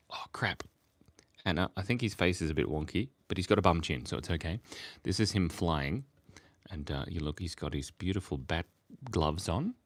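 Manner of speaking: ordinary talk, nothing marked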